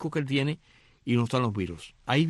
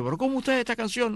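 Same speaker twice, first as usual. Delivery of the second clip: angry; 9 semitones higher than usual. Retold or imitated. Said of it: "él se pone bravo y le dice".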